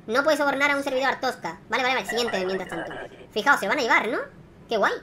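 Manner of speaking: ordinary talk, nothing marked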